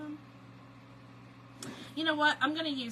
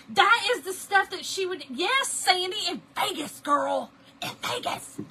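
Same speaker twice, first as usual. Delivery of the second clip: loud, high excited outburst; 9 semitones higher, 9 dB louder than usual. Real, not acted. Telling viewers what her cousin would do, excited.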